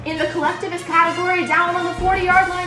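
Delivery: loud, high play-by-play announcer voice; sports announcer. Pretend. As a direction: call it like a live sportscaster. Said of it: "explain it as a sports announcer".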